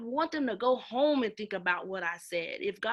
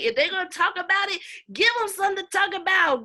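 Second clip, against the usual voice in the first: louder, higher and faster, hammering give; emphatic punch; 7 semitones higher, 7 dB louder, faster than usual. Real, not acted. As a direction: drive the motto home with force. Punchy repeat of her motto.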